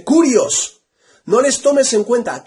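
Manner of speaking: high, loud cry to the Lord; kneeling outcry. Retold or imitated. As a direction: cry it out on your knees, pleading. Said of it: "cuando cae de rodillas dice".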